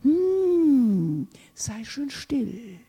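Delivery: drawn-out hum, slowing and softening; placating hush. Acted. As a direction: mockingly soothe someone into silence. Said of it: "Der Humanismus sagt".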